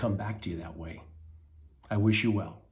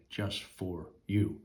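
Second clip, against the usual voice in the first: whisper; 4 semitones lower, 6 dB quieter, much slower than usual. Real, not acted. Intimate aside to listener.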